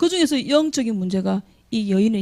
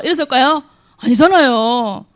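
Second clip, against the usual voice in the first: ordinary talk, nothing marked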